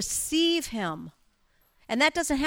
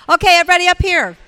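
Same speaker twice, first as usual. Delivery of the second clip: loud, fast call to the front; 7 semitones higher, 15 dB louder, faster than usual. Real, not acted. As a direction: round everyone up, loudly. Calling group to come forward.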